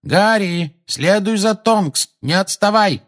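высокий протяжный крик-команда; окликни летящего товарища, растягивая слова высоким голосом; оклик издалека. A narrator's reading. (протяжно окликает)

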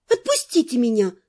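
ordinary delivery, nothing marked

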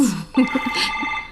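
short quiet giggle; teasing laugh. Acted. Friend laughs teasing the widow about younger men. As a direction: laugh at your own tease.

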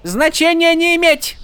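high, curt dismissal; mock Horneater accent. A narrator's reading. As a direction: cut him off. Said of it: "отрезала она".

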